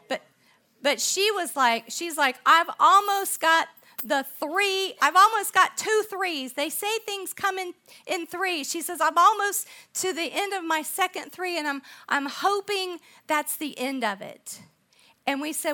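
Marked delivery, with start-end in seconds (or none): 2.5-8.6 higher and louder, mock exasperated waitress; imitating a frazzled waitress, raised voice; excited retelling. 9.0-14.6 higher and louder, mock exasperated waitress; imitating a frazzled waitress, raised voice; excited retelling. Waitress continues her complaint.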